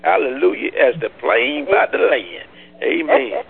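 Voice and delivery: high, loud delighted squeal with laughter. Real, not acted. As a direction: burst out joyful at the good news.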